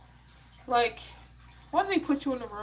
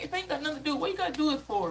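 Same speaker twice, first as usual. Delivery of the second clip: higher-pitched, mildly exasperated challenge; indignant retort; 7 semitones higher than usual. Retold or imitated. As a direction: argue back with raised pitch. Retold argument with the nurse.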